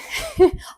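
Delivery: short, high-pitched laugh; quick high giggle; amused laugh. Real, not acted. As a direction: burst of real laughter. Amused by dog staring at her.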